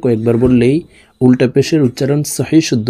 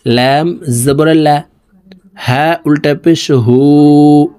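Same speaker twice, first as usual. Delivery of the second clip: slow sing-song spelling chant; patient lesson chant, lines drawn out; drill recitation; 3 semitones higher, 3 dB louder, much slower than usual. Shown as a demonstration. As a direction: recite each letter for the class to echo. Spelling out syllables.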